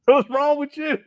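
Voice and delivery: normal talking voice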